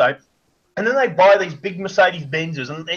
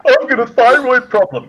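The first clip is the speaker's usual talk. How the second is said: higher, louder, put-on whine; mock whiny excuse; 6 semitones higher, 5 dB louder than usual. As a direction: sneer at a lame excuse. Mocking overweight person's excuse.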